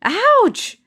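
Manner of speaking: very high yelled ouch; loud ouch. Acted. Amina yelling ouch at the second poke, very high. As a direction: shriek it even bigger this time. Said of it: "yelled Amina again".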